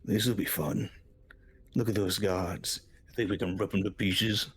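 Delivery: lightly voiced talking sword; a hint of a sword eager for a fight; slightly put-on character voice; Surtur. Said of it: "hear Surtur going".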